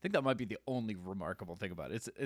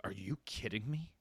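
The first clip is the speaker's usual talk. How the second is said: grumpy veteran scolding the rookie; disgusted disbelief; 2 semitones lower, 6 dB quieter than usual. Shown as a demonstration. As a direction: sneer it, genuinely annoyed. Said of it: "But if he came out and was like".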